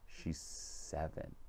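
slow, near-whispered self-reproach; hushed aside. Retold or imitated. Storyteller realising the listener is a child, hushed dismay. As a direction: drop to a slow whisper, as a private thought. Inner aghast aside after grand answer.

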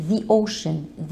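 "the" said as "thee" before ocean; correct pronunciation. Shown as a demonstration. Teacher shows the right way to say "the ocean". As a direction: say "the" as "thee", long ee. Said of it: "هتتنطق the ocean".

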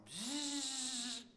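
high buzzing imitation of tires spinning; spinning-wheel buzz. Preacher imitating stuck car tires, high buzzing zzz. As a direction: buzz like wheels churning mud.

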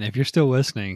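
ordinary talk, nothing marked

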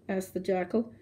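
ordinary delivery, nothing marked

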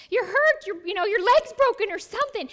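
loud, high and fast with panic; panicked rush. Retold or imitated. Dog owner panicking over her hurt puppy, loud and fast. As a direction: blurt it out in a frantic hurry. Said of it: "My first thought was".

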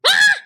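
sudden high frightened scream; scared scream. Acted. Shy snail shrieks at the kids in fright. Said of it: "I'm sorry I was so scared".

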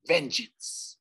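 hissed ghostly whisper. A narrator's reading. Ghost whispers to Nico, hissed. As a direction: breathe the word out.

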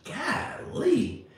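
usual speaking voice

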